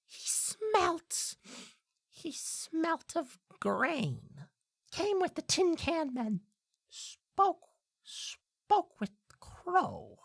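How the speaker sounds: very high bird voice, drawn out; halting high creature voice, word by word; cryptic blackbird. Acted. Blackbird telling the player what it saw, high and halting.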